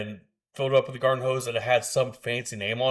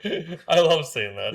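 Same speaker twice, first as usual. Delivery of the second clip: high, slow words through laughter; real delighted giggling speech; laughing; 5 semitones higher, 3 dB louder, much slower than usual. Real, not acted. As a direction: say it while cracking up. Cracking up at accent trick.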